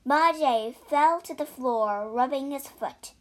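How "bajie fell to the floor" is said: ordinary delivery, nothing marked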